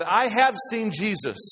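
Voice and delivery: loud, high proclamation; full-voiced preacher's climax; impassioned declaration. Real, not acted. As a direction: ring it out like a testimony.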